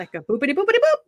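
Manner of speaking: bouncy mock Russian lilt; mock Russian inflection. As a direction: imitate her Russian sing-song. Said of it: "her inflections are different".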